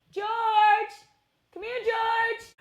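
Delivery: high, sung-out call to a dog. Real, not acted. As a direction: call the dog from across the house. Calling dog again.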